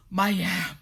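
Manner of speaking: panting words; out of breath. A narrator's reading. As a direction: gasp it out winded. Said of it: "Akwesi said out of breath".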